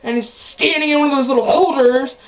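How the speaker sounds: loud, high bossy instruction; bossy mom. Retold or imitated. Mom directing her daughter to the flag, loud. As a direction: order her over to the flag. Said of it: "my mom goes".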